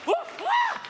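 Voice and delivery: very high falsetto whoops; hammed-up shrieky whoops; mock panicked yelps. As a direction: yelp like a frightened passenger. Repeats the mocked scared passenger's noises.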